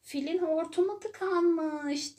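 high, loud, slow shout while running past; cheetah shouting. A narrator's reading. Cheetah racing past, shouting the news to everyone. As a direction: call it out as you dash by. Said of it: "diye bağırdı çıta".

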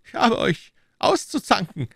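king pretending to scold; mock scolding king. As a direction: tease them with a scolding. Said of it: "sprach der König".

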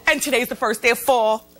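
usual speaking voice

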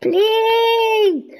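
high shouted please; desperate shouted please; 1 pleading shout. Real, not acted. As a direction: yell the plea. Begging the horse.

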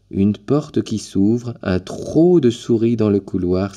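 ordinary delivery

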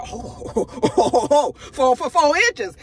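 laughing mock disbelief. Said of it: "I said".

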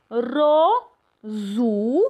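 "Rozu" with a strongly rolled, vibrating r; trilled r demo. Shown as a demonstration. Teacher stressing the vibration of the Polish r. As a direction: roll the r in "Rozu" hard. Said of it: "Porque tiene una vibración".

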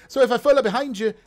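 high, loud, hammed-up Italian accent; bad Italian accent. Half-orc asking to tag along, in bad Italian accent. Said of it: "I lost the accent for a second".

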